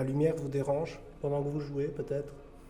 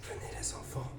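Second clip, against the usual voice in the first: library whisper; 7 dB quieter than usual. Acted. Appelle les esprits d'enfants.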